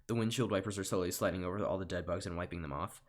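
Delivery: ordinary delivery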